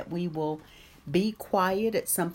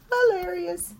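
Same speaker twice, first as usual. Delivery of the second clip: excited squeal; 12 semitones higher, 6 dB louder than usual. Real, not acted. High squeal on hilarious.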